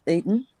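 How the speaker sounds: EATEN as eat-n, ending in n; swallowed eating. Shown as a demonstration. Australian showing how she says it, EATEN shortened to eat-n. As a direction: say EATEN with no vowel before the n. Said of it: "I just say".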